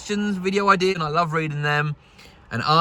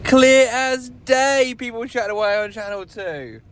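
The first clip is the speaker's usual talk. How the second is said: amazed and excited; 8 semitones higher than usual. Real, not acted. Vlogger hearing radio chatter at last, thrilled and amazed. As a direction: marvel slowly at how clear it is.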